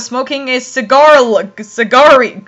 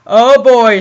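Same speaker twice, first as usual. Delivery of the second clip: ordinary delivery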